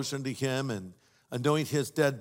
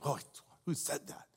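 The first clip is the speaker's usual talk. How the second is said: frightened whispered question; scared whisper; 3 semitones lower, 13 dB quieter than usual. Retold or imitated. Thief startled in the dark house, frightened whisper. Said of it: "thief in the dark".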